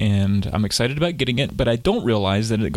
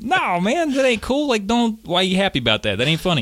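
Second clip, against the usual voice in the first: higher, half-joking outrage; mock indignant protest; 8 semitones higher than usual. Teller retells scolding clerk, higher mock indignation. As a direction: play up the disbelief, lightly.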